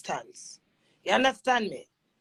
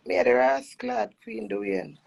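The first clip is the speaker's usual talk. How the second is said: normal talking voice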